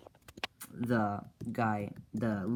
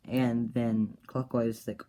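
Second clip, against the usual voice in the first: usual speaking voice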